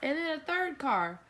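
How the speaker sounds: put-on baby talk. Shows toys, puts baby to bed.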